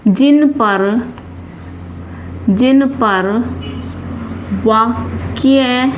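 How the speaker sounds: slow, word-by-word dictation; halting writing-along pace; dictation while writing. Real, not acted. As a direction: say it in small pieces as you write, with long gaps.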